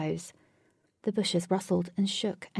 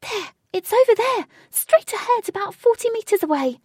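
high-pitched, loud warning shout; shouting from above; 9 dB louder than usual. A narrator's reading. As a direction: call down to the group, high and loud. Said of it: "shouted Jane from high up".

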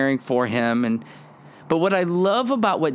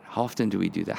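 ordinary delivery

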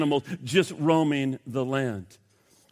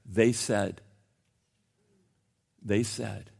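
much lower and softer; somber reading; 7 semitones lower, 6 dB quieter, slower than usual. Real, not acted. Pastor reading a woman's suicide note, somber. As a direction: let it sink heavily. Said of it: "suicide note simply read".

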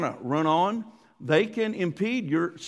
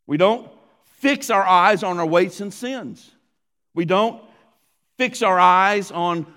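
louder emphatic sermon lines; preaching; 3 semitones higher, 7 dB louder than usual. Acted. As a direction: drive each point home from the pulpit. Sermon delivered at full force.

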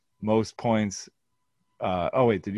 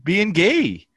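high mock voice; 11 semitones higher, 7 dB louder than usual. Teasing the winner.

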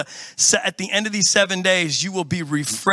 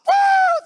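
very high, loud woo; hammed-up celebratory whoop; joyful whoop; 5 dB louder than usual. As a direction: let out a thrilled whoop. Excited about express lane.

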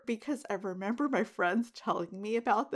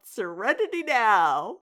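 loud mock scream, swelling on "now"; imitated scream; 8 dB louder than usual. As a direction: scream it out like a worked-up sitcom dad. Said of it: "he would just scream out".

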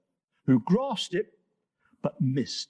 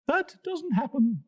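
high antelope voice; 10 semitones higher than usual. Acted. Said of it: "said the antelope".

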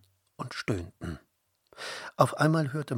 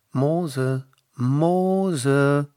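loud, drawn-out higher call; voice from the fire; 4 semitones higher, 6 dB louder than usual. A narrator's reading. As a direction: summon him solemnly, stretching the name. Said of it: "hörte Mose eine Stimme".

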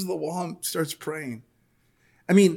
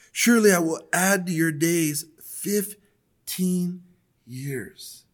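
measured, solemn Bible reading voice; preacher reading Isaiah aloud, louder and deliberate; scripture reading; 3 semitones higher, 4 dB louder than usual. Acted. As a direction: read the passage out to the church with weight. Reads scripture aloud to congregation.

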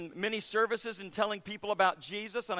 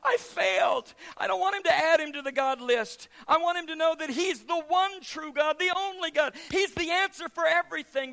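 higher, louder anxious inner voice; I failed, what now; panicked thought; 8 semitones higher, 6 dB louder than usual. Retold or imitated. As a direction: replay the panic of failing. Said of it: "and I'm thinking".

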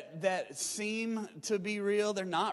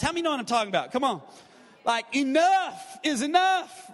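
usual speaking voice

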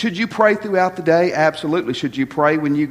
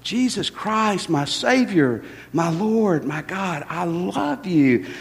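soft, slow, hushed words of love; tender prayer; 3 dB quieter, slower than usual. Shown as a demonstration. Preacher demonstrates praying to the Spirit, soft and devoted. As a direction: murmur it devotedly, hand on chest. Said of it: "pat myself on the chest ... and say".

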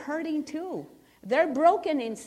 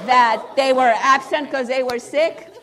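louder, laughing through it; laughing in disbelief; 12 dB louder than usual. Real, not acted. Telling the church about the kids, loud and laughing. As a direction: laugh at how absurd it is. Retelling principal's news, laughing.

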